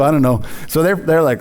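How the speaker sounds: usual speaking voice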